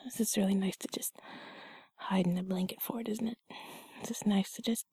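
sleepy cozy whisper. Acted. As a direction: hush it under the blanket. Held whisper.

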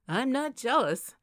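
loud, high, drawn-out denial; protesting. A narrator's reading. Evan denies to Kate that he is jealous, protesting. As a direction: protest slowly and emphatically. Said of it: "Evan protested".

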